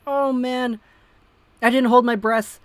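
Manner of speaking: usual speaking voice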